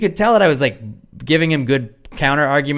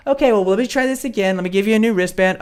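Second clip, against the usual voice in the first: higher, placating gate-staff voice; helpful staff member; 9 semitones higher than usual. Retold or imitated. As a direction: put on a high, accommodating attendant.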